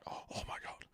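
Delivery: breathy whispered oh my God; whispered shock. Retold or imitated. Patient hearing the diagnosis, whispering in shock. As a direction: gasp it under your breath.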